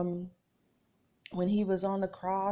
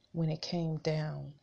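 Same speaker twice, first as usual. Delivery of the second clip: ordinary talk, nothing marked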